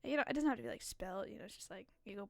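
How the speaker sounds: normal talking voice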